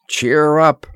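ordinary delivery

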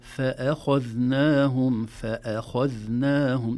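فأخذناهم slow and loud, light fronted hamza; ترقيق الهمزة. Shown as a demonstration. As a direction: recite فأخذناهم deliberately with a thin "fe-e". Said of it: "لا نفخم الهمزة". (فأخذناهم ببطء بهمزة مرققة)

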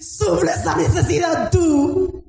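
high, strained exhortation; impassioned preaching. Real, not acted. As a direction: preach with full fervour, drawing out the key words. Preaches at full force.

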